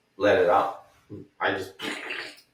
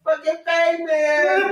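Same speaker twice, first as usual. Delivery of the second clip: camp cheerleader; slower than usual. Pretend. Filming the scene, a hammed-up high shouted cheer. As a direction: egg them on like a porn director. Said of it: "be like".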